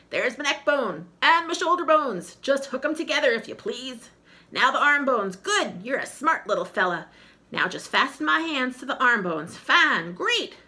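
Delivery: talking skull. Acted. Skull directs assembly.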